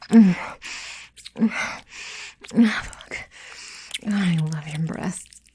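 normal talking voice

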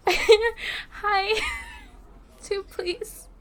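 high voice through leftover laughter; giggly greeting. Acted. Caught laughing while asking for a table, giggly high greeting. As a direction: try to speak while giggling.

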